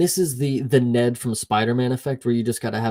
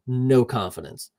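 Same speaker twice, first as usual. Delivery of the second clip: ordinary talk, nothing marked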